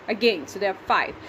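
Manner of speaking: ordinary talk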